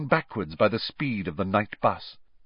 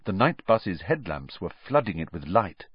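plain reading voice

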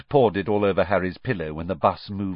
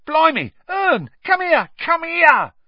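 high, loud excited shout; 8 dB louder than usual. Acted. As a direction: yell across to the driver in amazement. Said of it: "Stan shouted".

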